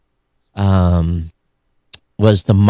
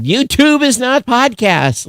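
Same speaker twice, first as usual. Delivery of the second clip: much higher, slower, emphatic mock complaint; mock audience protest; 14 semitones higher than usual. Host quoting listener feedback, high mock indignation.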